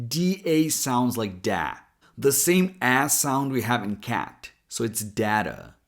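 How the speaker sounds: "data" with DA as in cat; data with cat vowel. Shown as a demonstration. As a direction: open "data" with a flat a. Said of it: "In version two".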